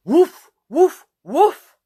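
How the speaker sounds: hammed-up puppy barks; baby barking like a dog. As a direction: bark like a toddler playing dog. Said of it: "says Jackie".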